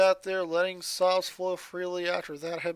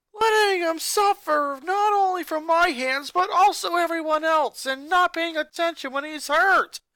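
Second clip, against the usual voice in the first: bitter outburst; 11 semitones higher, 7 dB louder than usual. Acted. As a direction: spit out the shame hard. Sister berates herself and family.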